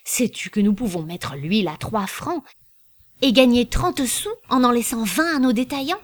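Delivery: ordinary delivery, nothing marked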